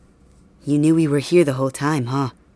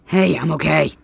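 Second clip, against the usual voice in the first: ordinary talk, nothing marked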